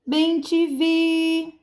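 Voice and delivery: Bem te vi slow and high, VI held long; street vendor's call. Shown as a demonstration. Teacher selling the bird's name to pupils, vendor's call. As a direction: call out each syllable like a hawker. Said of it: "é você vender a palavra".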